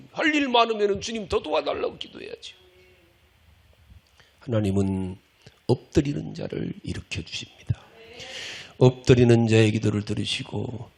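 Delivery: ordinary delivery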